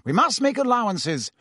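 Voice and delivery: flustered attempt to smooth things over; startled. A narrator's reading.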